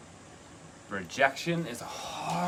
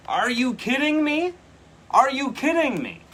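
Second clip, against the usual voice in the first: very high, loud squeal of disbelief; thrilled disbelief; 10 dB louder than usual. Real, not acted. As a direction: shriek it in amazement. Card she wanted flips out.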